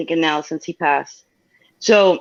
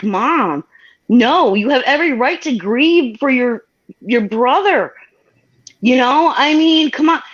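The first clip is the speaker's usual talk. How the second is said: pleading with mom; 6 semitones higher, slower than usual. Retold or imitated. Daughter urging grieving mom, earnest raised plea. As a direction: insist she has the right to grieve.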